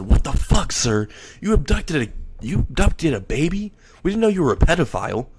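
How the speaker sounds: the crew jumping on their boss in disbelief; shocked outcry. Acted. Employees shouting at their boss over the stolen baby.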